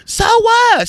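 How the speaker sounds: loud falsetto retort; squawked mock defiance; mimicked angry friend. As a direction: throw it back high and loud, mocking her.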